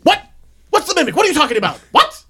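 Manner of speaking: loud, high, exasperated questioning; re-enacted worked-up confusion; acted agitated demand. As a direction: yell for an explanation. Acted alarmed demand for explanation.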